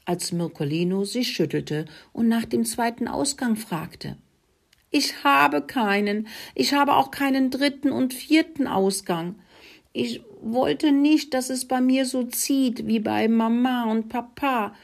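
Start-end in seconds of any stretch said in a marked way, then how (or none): 4.9-14.7 sobbing. A narrator's reading. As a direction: confess it in a high wail. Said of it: "schluchzte sie". (weinerlich, mit hoher Stimme)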